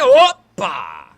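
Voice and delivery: loud, punchy hoppa; loud playful exclamation; excited hoppa. Real, not acted. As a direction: shout it as the car lunges. Overtake call.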